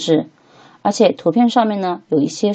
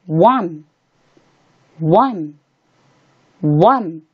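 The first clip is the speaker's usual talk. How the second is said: slow, spaced repetitions of one for learners; slow model reading; much slower than usual. Shown as a demonstration. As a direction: say one slowly, drawn out with pauses. Teacher models number for repetition.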